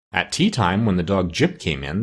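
ordinary delivery, nothing marked

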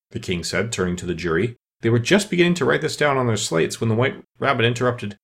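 ordinary delivery, nothing marked

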